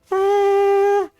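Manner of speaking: very high mock kazoo note; kazoo toot. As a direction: toot it like a little brass kazoo. Waiter toots a kazoo.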